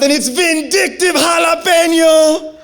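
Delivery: high, slow shout about the pepper; pained shout. Real, not acted. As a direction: yell it like it burns. Telling the third pepper burn.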